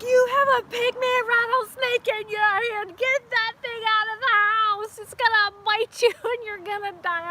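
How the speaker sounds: alarmed mom. Retold or imitated. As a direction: order it out like a frightened mother. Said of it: "she's like".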